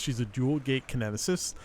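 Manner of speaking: usual speaking voice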